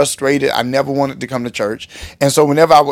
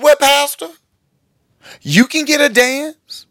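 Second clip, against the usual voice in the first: high put-on woman's voice; imitated woman's offer; 12 semitones higher, slower than usual. Woman offers pastor a dance, high teasing imitation. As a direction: pitch it way up, stretching the offer.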